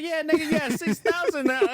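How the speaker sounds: loud, very high teasing voice; high, loud joking retort; mock company reply. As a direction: put on a high voice to rib a friend. Friend mocks with invented company reply.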